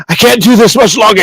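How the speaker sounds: high, strained voice; desperate magistrate. Acted. Magistrate telling the captain he is failing, strained desperation. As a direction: force it out at your limit.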